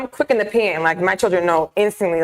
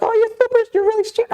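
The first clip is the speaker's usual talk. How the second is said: high teasing mom voice, much higher pitch; mom's voice. Retold or imitated. Mother teasing her daughter about strictness, high and light. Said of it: "my mom's like".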